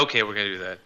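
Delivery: low, soft resigned giving in; meek giving in. Pretend. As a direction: give in sheepishly. Caves in right after.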